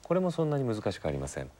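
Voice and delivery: ordinary delivery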